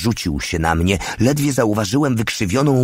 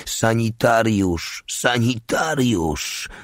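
high, slow call for a medic; drawn-out plea, fading on the repeat; wounded man's cry; 4 semitones higher, slower than usual. A narrator's reading. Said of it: "krzyczał ranny żołnierz".

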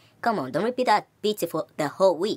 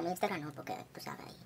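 hushed aside; whispering; 2 semitones lower, 16 dB quieter, faster than usual. Real, not acted. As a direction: drop to a whisper. Hinting at Instagram secret.

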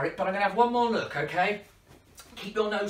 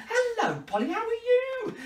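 high, friendly hello to a pet; high sweet greeting; 14 semitones higher than usual. Pretend. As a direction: coo at the puppet bird. Greeting the parrot puppet.

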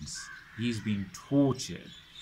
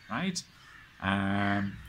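usual speaking voice

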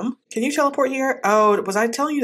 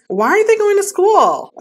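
high, loud, exasperated "Why aren't they going to school?"; frustrated shout; 11 semitones higher, 6 dB louder than usual. Real, not acted. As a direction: yell it out in real frustration. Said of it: "I'm so mad".

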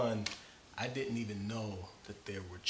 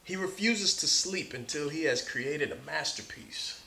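bright, lively read-aloud, a bit higher and louder; story narration; 5 semitones higher, 7 dB louder than usual. Acted. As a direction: read it like to a class of kids. Reads picture book aloud.

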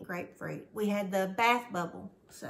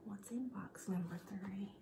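whispering; 10 dB quieter than usual. Real, not acted. Whispered preview of box.

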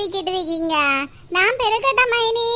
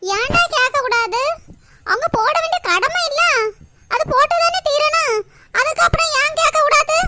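indignant raised voice; 8 semitones higher, 5 dB louder than usual. Acted. Mother arguing over dowry.

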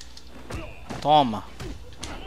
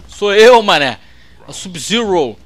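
louder, cocky announcement of himself; loud gamer boast; 2 semitones higher, 7 dB louder than usual. Pretend. Gamer boasting to his opponent as Sub-Zero, loud cocky boast. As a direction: crow it at the screen like the fighter himself.

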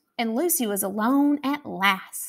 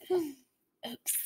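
hushed, soft little oops; quiet aside; 3 semitones higher, 13 dB quieter than usual. A narrator's reading. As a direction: whisper it guiltily. Whispered narrator aside.

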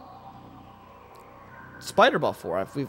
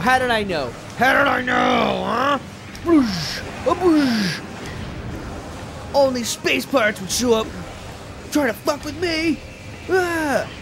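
booming high wrestler promo shout; 10 semitones higher than usual. Pretend. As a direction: hype it up like a pro wrestler. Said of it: "I'm trying out my wrestler voice".

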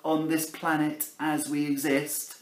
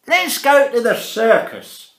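a hint of a cheery grandpa, a bit louder; lightly voiced grandpa; 7 semitones higher, 8 dB louder than usual. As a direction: suggest it like a jolly old man. Grandpa proposes circus.

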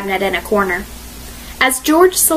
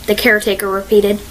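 plain reading voice

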